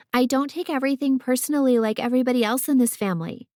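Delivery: put-on fast, high, dismissive child's voice. A narrator's reading. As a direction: toss it off like a scornful little girl. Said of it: "with a dismissive snort".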